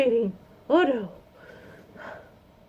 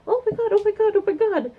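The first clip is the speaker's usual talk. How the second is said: high, loud repeated oh my god; squealing fangirl; 12 semitones higher, 5 dB louder than usual. Retold or imitated. The niece getting her badges, high loud squeals. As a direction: gush like a thrilled kid.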